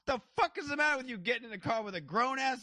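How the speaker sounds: very high, outraged imitated scolding; mock yelling. Prankster scolds the crying kid, mock yelling. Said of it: "like, yells at him".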